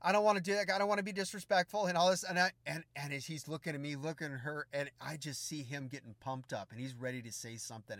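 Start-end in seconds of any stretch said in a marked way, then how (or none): none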